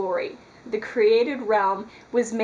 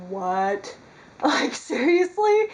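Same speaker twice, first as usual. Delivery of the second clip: replaying her stunned reaction, climbing much higher on seriously; a laughing, incredulous what, then seriously pitched way up; mock disbelief; 5 semitones higher, much slower than usual. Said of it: "And I was just like".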